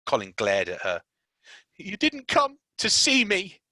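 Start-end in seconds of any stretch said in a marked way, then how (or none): 1.8-3.6 high, slow whiny complaint; sick boy sulking at Mary; sulky spoilt boy. Acted. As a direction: whine like a spoilt child. Colin voiced, sulky.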